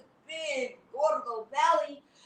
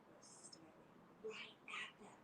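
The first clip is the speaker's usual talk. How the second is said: low, soft and unvoiced; spooky hush; 9 semitones lower, 21 dB quieter than usual. A narrator's reading. Narrator building suspense for the kids, spooky whisper.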